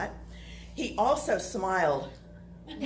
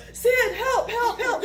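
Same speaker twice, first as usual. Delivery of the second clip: high, loud pretend distress; playful damsel-in-distress squeal; mock cry for help; 14 semitones higher, 6 dB louder, slower than usual. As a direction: call the dog to a fake rescue. Acting a mock call for rescue.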